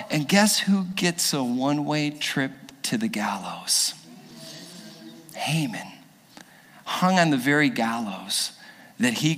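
hushed reveal of the villain's end; preacher hushing for Haman's fate; dramatic whisper. A narrator's reading. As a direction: whisper it like a secret punchline.

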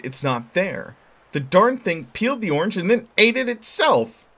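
put-on high, loud boy voice; a kid complaining loudly about the machine; boy's voice, indignant. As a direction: play Billy as a fed-up kid.

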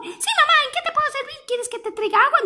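put-on high eager child voice; eager little turtle offering to help her mother; little tortoise voice. Little turtle answering mama, high and eager.

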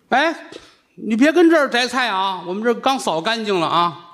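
a hint of nagging nuns, pitched high; scolding nuns. Nuns scolding the vegetable seller off their doorstep, high and fussy. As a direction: shoo him off, high and fussy.

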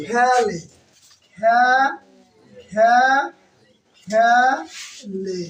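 খেলে as khæ-le, the khæ held and split from le; syllable-by-syllable pronunciation. Shown as a demonstration. Teacher showing where the æ sound comes from, খেলে split as khæ, le. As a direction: break খেলে into a long open "khæ", then "le". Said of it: "উচ্চারণটা আসলে কি".

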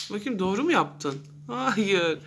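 normal talking voice

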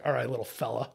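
low, soft talk to a quarry; sly coaxing. Retold or imitated. Angler murmuring to the fish on his line, slyly coaxing. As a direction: drop it down, quiet and confiding.

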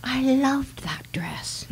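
slow, soft whispered memory; wistful whispered recollection; dreamy whisper. Acted. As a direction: breathe it out, lost in nostalgia. Mother fondly remembers dress.

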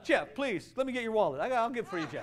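high, loud desperate begging; a church hammed-up pleading for money. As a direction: beg him as if the church is going under. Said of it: "I gotta plead with you".

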